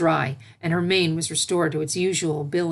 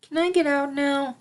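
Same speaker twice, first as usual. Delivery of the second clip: high, small pleading filly; tiny pleading voice; 9 semitones higher than usual. A narrator's reading. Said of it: "in a tiny, pleading voice".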